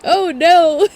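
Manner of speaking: a wail of dismay; dismayed cry. Real, not acted. Streamer seeing the ring not fit, crying out in dismay. Streamer reacting to sad news.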